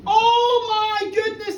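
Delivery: slow, loud drawn-out gasp of alarm; shocked Peggy. Retold or imitated. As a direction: drag out the shock. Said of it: "says Peggy".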